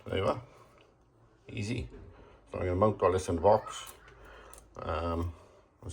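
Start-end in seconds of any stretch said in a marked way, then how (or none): none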